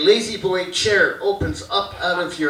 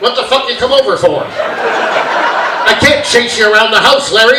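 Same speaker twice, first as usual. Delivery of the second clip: loud mock-angry dad rant; scolding dad; 4 semitones higher, 8 dB louder than usual. Dad berates son from his chair, loud scolding. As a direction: berate your kid from the armchair.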